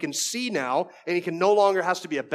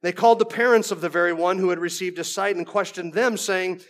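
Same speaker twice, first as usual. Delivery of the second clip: ordinary talk, nothing marked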